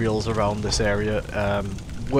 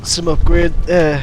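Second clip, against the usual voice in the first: loud self-correction; 6 semitones higher, 10 dB louder than usual. Real, not acted.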